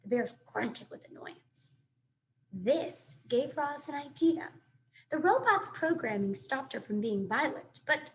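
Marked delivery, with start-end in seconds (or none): none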